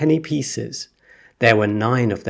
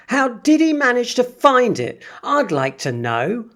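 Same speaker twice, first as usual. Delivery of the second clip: angry shout; 13 semitones higher than usual. Acted. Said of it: "a large boy shouted angrily".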